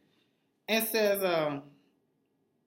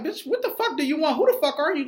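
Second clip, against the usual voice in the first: higher, louder mock blowup at her; imagined angry comeback; 12 semitones higher, 7 dB louder, faster than usual. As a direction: snap back like she's wasting your time. Speaker's own imagined retort.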